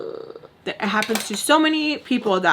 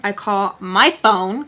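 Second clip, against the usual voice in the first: normal talking voice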